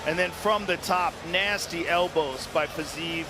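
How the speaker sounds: ordinary delivery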